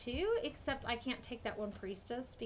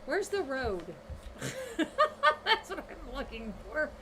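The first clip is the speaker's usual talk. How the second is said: laughing; 5 semitones higher, 6 dB louder than usual. Real, not acted. Laughing at lost road.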